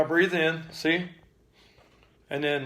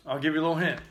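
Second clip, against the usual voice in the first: ordinary delivery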